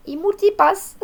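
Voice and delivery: high-pitched quoted catchphrase; put-on high movie-character voice; mock movie character. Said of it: "qui arrive et qui fait".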